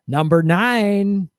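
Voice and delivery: slow, suspenseful countdown reveal; announcer milking the big reveal; game show host. Acted. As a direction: punch out the country like a prize. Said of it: "read about death squads in the game show voice".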